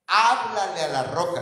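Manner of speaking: "Háblale" punched loud at the start; the Lord's firm order, "Háblale" driven home; stressed command. Retold or imitated. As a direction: lean hard on "Háblale a la roca". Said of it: "No le dijo, "Golpea la roca".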